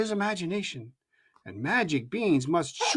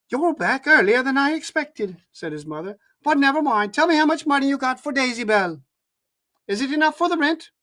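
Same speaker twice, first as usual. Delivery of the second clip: mother's voice; 6 semitones higher, 4 dB louder than usual. A narrator's reading.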